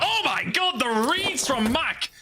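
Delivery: sudden high hype over the big play; excited. Real, not acted. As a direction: erupt with excitement, pitch way up. Big play, caster erupts.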